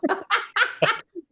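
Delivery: high-pitched burst of laughter; high, helpless giggling; delighted laugh. Real, not acted. Laughs at own accent impression.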